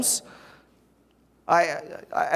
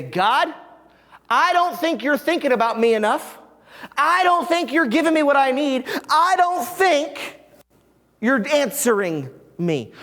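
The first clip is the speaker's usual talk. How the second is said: raised, frustrated complaint; complaining to God; 7 semitones higher than usual. Retold or imitated. Believer complaining to God that he isn't listening, raised and frustrated. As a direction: let the hurt push the pitch up. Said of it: "biblical permission to say".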